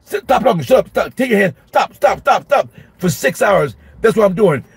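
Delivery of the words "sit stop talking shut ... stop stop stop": high-pitched, rapid barrage of repeated orders; drill sergeant teacher. Retold or imitated. Teacher repeating orders for six hours, drill-sergeant style. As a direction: fire off commands like a drill sergeant. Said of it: "all I was was a teacher drill sergeant".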